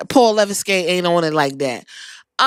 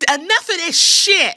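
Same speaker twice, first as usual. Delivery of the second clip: higher, outraged commentator cry; mock outraged call; 8 semitones higher than usual. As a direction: pitch it up like a fed-up announcer. Commentator Michael Cole quoted.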